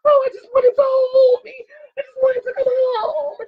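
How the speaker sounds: high, loud imitation wail; mock crying. Host imitating a crying wife on a dating show, mock wailing. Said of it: "she's crying, and she's like".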